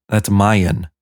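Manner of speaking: "mine" stretched into "mai-en"; odd pronunciation. Shown as a demonstration. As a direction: say "mine" as two beats, "mai-en". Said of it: "That's how they say it".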